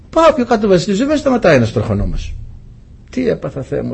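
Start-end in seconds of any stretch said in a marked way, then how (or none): 3.1-3.9 low, hushed "oh my God" under the breath; dismayed mutter. Retold or imitated. Preacher recalls being stopped by police, hushed dismay. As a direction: quietly groan at being caught.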